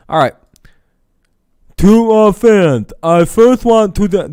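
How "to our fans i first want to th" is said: much higher, slow, pompous official voice; squeaky mocking voice of the commissioner; mock Rob Manfred voice. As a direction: ham up a stuffy commissioner reading a letter. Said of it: "the actual voice of Rob Manfred".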